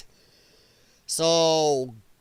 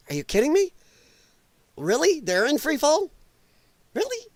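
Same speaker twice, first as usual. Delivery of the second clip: mock incredulity; 8 semitones higher than usual. Scoffing at the quoted claim.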